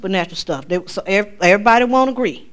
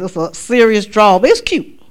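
ordinary talk, nothing marked

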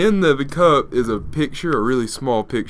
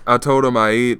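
usual speaking voice

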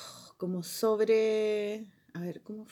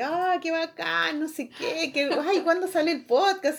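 mimicked excited crowd; 7 semitones higher, 4 dB louder than usual. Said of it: "y toda la gente".

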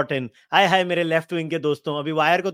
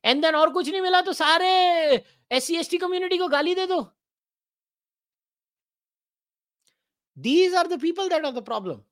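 mocking caricature voice; 9 semitones higher, much faster than usual. Mocking opponents' talking points.

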